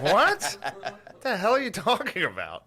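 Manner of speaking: high, loud, incredulous through laughter; laughing mock disbelief. Couple questioning the intruder, laughing disbelief. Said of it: "And they're like".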